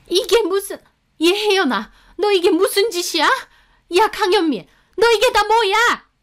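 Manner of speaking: high-pitched, appalled mother; shocked outrage. A narrator's reading. Mother-in-law demands explanation from both cheaters, appalled. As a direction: cry out in scandalized disbelief, pitched high.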